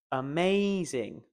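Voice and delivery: slow "amazing" with a long MAY; light "a", drawn MAY, falling "zing"; stress on MAY. Shown as a demonstration. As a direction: stretch the middle syllable, a-MAAAY-zing. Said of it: "focus on the may".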